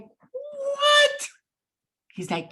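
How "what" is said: high squeaky shocked what. Retold or imitated. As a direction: squeal it in disbelief. Shocked reply in retold story.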